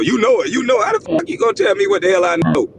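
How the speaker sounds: ordinary delivery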